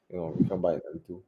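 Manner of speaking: quiet aside. Real, not acted. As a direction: drop it to a quick quiet aside. Repeats the line as an aside.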